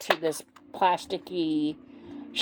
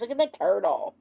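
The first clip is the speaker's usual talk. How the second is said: usual speaking voice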